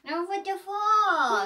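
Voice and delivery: put-on high, slow baby-talk echo of the toddler; sing-song copy of a toddler. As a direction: repeat the child's words like a little kid. Mother echoes her toddler's words.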